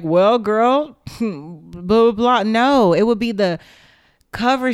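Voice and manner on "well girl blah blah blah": a louder mock dismissive brush-off with a laugh; mock them waving it away; laughing, louder dismissive impression; dismissive mockery.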